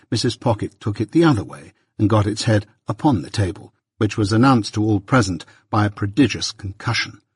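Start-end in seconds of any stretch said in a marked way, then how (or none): none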